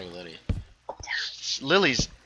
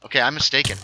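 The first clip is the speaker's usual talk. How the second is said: ordinary talk, nothing marked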